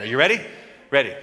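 normal talking voice

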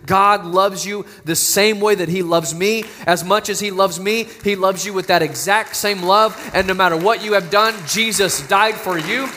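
usual speaking voice

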